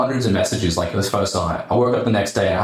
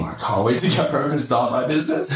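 normal talking voice

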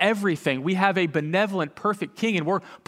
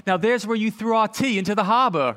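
higher-pitched mock British accent; 4 semitones higher than usual. British mom showing a visitor Boston Harbor, imitated British accent. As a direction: imitate a British mom playing indignant tour guide. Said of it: "in her British accent".

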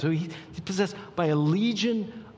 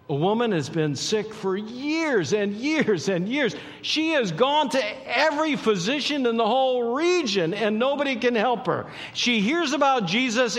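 high, impassioned storytelling; full-force preaching; 9 semitones higher, 5 dB louder than usual. Real, not acted. Preacher telling of the sick woman reaching for Jesus, full-force and high. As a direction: drive it high, stretching the key words toward the end.

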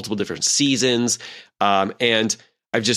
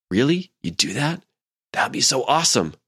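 imitating a delighted kid, slightly higher; lit-up delight; 3 semitones higher than usual. Daughter to parent offering to watch anime, delighted. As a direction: voice a mildly excited daughter's surprise. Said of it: "her eyes totally lit up".

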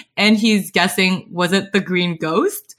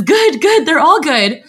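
excited; 7 semitones higher, 5 dB louder than usual. A narrator's reading. Excited answer.

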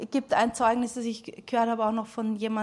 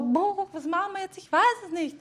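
much higher, overwhelmed and clueless; helpless mom; 9 semitones higher, 3 dB louder than usual. Retold or imitated. Mother admits to her teenagers she has no idea, high mock panic. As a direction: throw up your hands in mock panic. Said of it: "Ich bin oft so".

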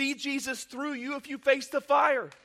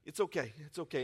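soft reassurance; 8 semitones lower, 8 dB quieter than usual. Real, not acted.

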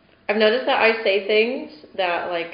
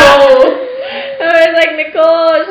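laughing; 7 semitones higher, 7 dB louder, slower than usual. Real, not acted. Mock shock at friend's story.

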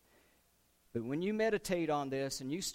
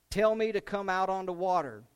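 raised, louder voice calling across water; eager call; 4 semitones higher, 8 dB louder than usual. Acted. Peter calling to Jesus on the water, loud and eager. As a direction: call out boldly to the Lord.

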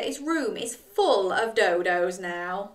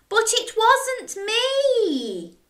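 Jack wailing; 12 semitones higher, slower than usual. Acted. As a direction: wail it like a blamed child. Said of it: "library was awful".

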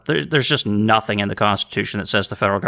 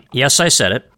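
ordinary delivery

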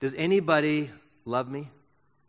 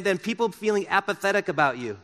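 normal talking voice

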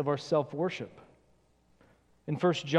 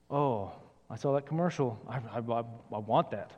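lower, softer murmur of wanting; mock craving; 8 semitones lower, 6 dB quieter than usual. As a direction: quietly mimic someone hooked by an ad. Consumer craving after commercial.

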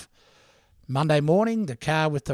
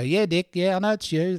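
higher, knowing mock voice of the girl; weary ad girl; 5 semitones higher than usual. Girl at the newspaper answering Dick's call, mildly mocking. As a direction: play the girl who already knows it's him. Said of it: "And the girl go".

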